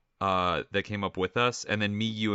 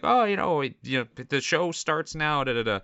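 higher-pitched, lightly imitated show host; Papyrus; 6 semitones higher than usual. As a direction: toss off a casual show announcement, higher. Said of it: "Papyrus is like".

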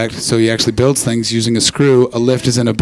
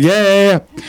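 higher-pitched mocking yeahs; mocking laugh imitation; 6 semitones higher, slower than usual. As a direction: mimic someone's laughing response, pitched up. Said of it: "I don't like that you laugh".

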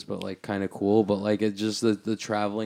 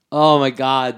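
loud, higher exasperation; exasperated cry; 4 semitones higher, 9 dB louder, slower than usual. Real, not acted. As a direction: blurt it out, fed up. Driven crazy by the noise.

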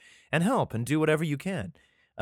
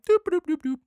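very high playful doop, doop, doop; silly doop noises; 4 dB louder than usual. Retold or imitated. Egg-taker helping himself to the blue jay's nest, chirpy doops. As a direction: bop along cheerfully like you're stealing eggs. Imitating taking the bird's eggs.

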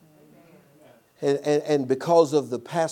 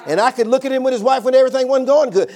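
rising, louder preaching cadence; 5 semitones higher, 5 dB louder than usual. Acted. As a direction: build the point like a pulpit sermon. Preacher drives point home.

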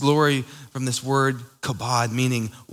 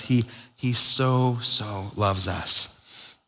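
slow, softer and lower on "so loves us"; tender emphasis; 5 semitones lower, 4 dB quieter, much slower than usual. Real, not acted. Preacher lingering on God's love for the listeners, slow tender emphasis. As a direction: let it sink in gently.

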